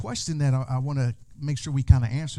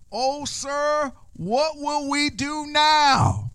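high, slow panicked cry; frightened servant; 11 semitones higher, much slower than usual. Acted. Servant to Elisha at dawn, high and panicked. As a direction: plead with the prophet in alarm.

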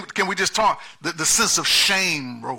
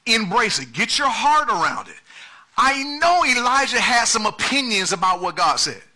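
higher, louder preaching build; preaching at full force; 4 semitones higher, 3 dB louder than usual. Acted. Pastor driving home the point to the church, at full force. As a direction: drive the point home line by line.

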